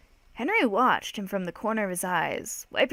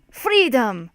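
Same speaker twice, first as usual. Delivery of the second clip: louder, higher mock battle cry; accented shout, pitched up; shouted war cry; 4 semitones higher, 7 dB louder than usual. A narrator's reading. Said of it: "we shouted".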